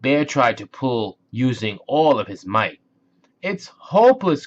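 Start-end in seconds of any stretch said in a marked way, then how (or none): 3.4-4.5 despairing cry. Acted. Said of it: "cried Moose".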